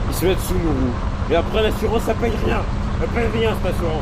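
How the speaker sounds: high, loud mock-outraged complaint; mock rant. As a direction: grumble at the useless insurance. Ranting about bad drivers.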